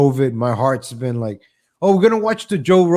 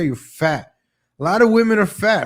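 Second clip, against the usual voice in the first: ordinary delivery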